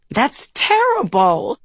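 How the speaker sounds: high, slow, out of breath from laughing; wheezing with laughter. A narrator's reading. Sabrina hears of the recording disaster, wheezing for breath. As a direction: squeeze it out between wheezes. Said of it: "wheezing for breath".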